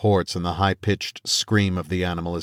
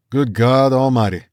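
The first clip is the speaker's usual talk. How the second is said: higher, louder Cajun drawl; 5 semitones higher, 4 dB louder than usual. A narrator's reading. Cajun hunter marveling at the animal, Cajun drawl. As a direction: stretch it out in a thick Cajun accent. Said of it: "drawled one of the men in a thick Cajun accent".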